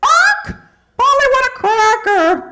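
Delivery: high squawking parrot, far up in pitch. Retold or imitated. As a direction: squawk it like a parrot begging for food. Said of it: "everybody talks when they're hungry".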